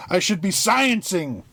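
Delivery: high-pitched comic outburst; mock scream. Scientist protesting that she should be sciencing, mock scream. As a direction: protest shrilly, half shouting through amusement. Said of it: "where she is screaming".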